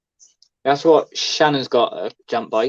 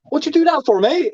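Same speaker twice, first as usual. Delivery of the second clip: high-pitched incredulous imitation; much higher put-on bafflement; mock questioning; 14 semitones higher than usual. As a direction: mimic a daft question, pitched right up. Mimics daft question after a fall.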